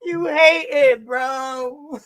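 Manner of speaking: loud, slow taunt; teasing shout. Real, not acted. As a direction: call him a hater, loud and drawn out.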